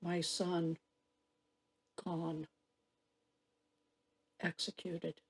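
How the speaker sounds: low, hushed, stunned mourning; quiet grief. Acted. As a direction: let each short phrase sink into silence. Bereaved mother opens monologue.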